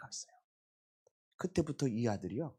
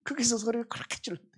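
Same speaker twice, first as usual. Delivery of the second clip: amused outburst; 13 semitones higher, 8 dB louder, faster than usual. Real, not acted. Preacher laughing at son's yell.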